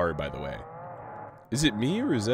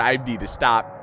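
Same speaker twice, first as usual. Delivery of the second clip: usual speaking voice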